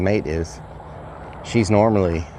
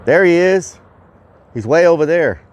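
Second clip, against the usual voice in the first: high, loud excited sighting; 8 semitones higher, 5 dB louder than usual. Real, not acted. Vlogger spots the hawk for the viewers, excited. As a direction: call it out with excitement, pitched well up.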